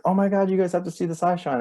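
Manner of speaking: high, fast urgent gush; imitated excitement. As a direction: act out a friend urging everyone to look. Retelling friend urging others to look.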